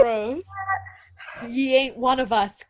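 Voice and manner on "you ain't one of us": hollow gravelly croak. Acted. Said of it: "he croaked in a hollow, gravelly voice".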